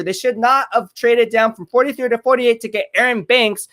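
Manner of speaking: normal talking voice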